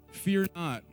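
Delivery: ordinary talk, nothing marked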